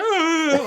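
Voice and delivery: high, loud fake wail; mock crying. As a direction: bawl like someone in tears, hammed-up. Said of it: "comes out of the car crying in tears".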